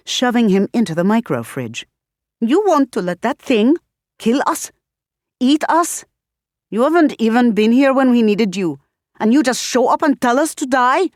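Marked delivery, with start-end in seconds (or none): 2.4-11.1 high, loud, furious accusation; angry outburst. Acted. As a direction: shout it at him, outraged. Padmini explodes at Paolo.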